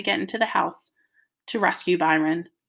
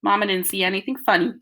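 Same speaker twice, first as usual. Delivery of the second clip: ordinary delivery, nothing marked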